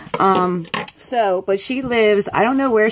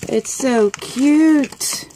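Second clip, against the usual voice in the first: high, stretched-out gushing over something cute; delighted cooing; 6 semitones higher, much slower than usual. Real, not acted. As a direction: coo it high and very slow. Seeing the cute gift.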